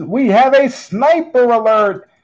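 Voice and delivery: high, loud sudden alert; excited announcement. Real, not acted. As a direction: announce the sniper bid with a jolt. Late bid surprises the host.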